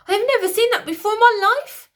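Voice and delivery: high, loud frantic protest; high loud royal protest; queen protesting. A narrator's reading. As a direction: plead innocence hard. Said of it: "protested the Queen".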